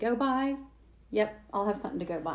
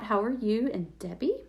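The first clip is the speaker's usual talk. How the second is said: ordinary talk, nothing marked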